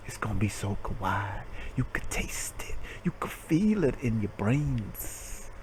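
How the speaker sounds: mock seductive whisper about the game; creepy soft whisper. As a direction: draw them in with a hush. Softening into whisper.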